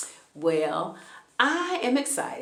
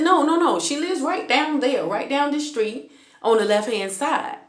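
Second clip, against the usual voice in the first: high, louder urgent no no no; raised pitch, pleading insistence; insistent protest; 7 semitones higher, 3 dB louder than usual. Retold or imitated. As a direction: insist she lives right there. Insisting to cops.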